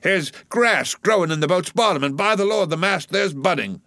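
high-pitched, excited goading of rowers; rousing cry. A narrator's reading. Stubb goads his oarsmen to beat the German, rousing cry. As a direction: harangue the crew, pitch soaring on each urging. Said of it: "cried Stubb to his crew".

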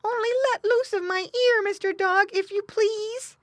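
high, loud piglet voice; pleading piglet. A narrator's reading. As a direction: beg politely in a high squeal. Caught piglet begs the dog.

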